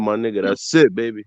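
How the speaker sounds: usual speaking voice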